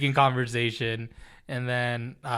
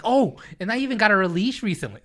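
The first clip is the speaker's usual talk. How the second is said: higher, louder gushing about the cat; eager pet owner bubbling over; retold excitement; 8 semitones higher, 4 dB louder than usual. Telling a guy about his cat leash, excitedly. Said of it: "I got all excited talking about Cardi".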